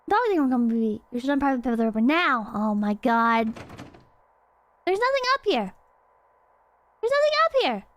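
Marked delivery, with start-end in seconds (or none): 4.9-7.8 high, exasperated whine; high-pitched complaint. Real, not acted. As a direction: complain in a raised pitch at finding nothing. Frustrated searching in a horror game.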